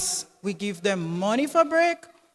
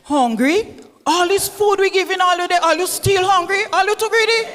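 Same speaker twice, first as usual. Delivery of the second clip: indignant scolding; 6 semitones higher, 4 dB louder than usual. Acted. Mother scolds the children.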